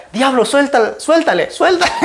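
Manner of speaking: very high, frantic mock command; hammed-up exorcism cry. Retells casting out devil while chasing. (exorcismo exagerado, con voz muy aguda)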